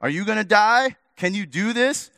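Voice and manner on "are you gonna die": ordinary delivery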